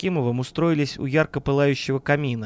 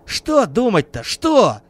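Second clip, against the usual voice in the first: raised, exasperated outburst; flaring up; 7 semitones higher, 5 dB louder, much slower than usual. A narrator's reading. Man rejects his friend's call to think, flaring up. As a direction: explode on the question, high and loud. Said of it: "взвился Кузнецов".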